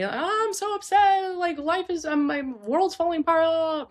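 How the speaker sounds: high-pitched wail of a distraught kid, acted; mock upset scream. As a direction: imitate a kid screaming in despair, held high. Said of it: "screams, right?".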